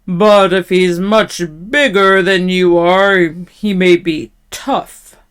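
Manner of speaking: slow, loud, doubtful troll. A narrator's reading. Troll questioning the little goat, slow and loud. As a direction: weigh it up slowly as a troll. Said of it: "the troll in his great loud voice".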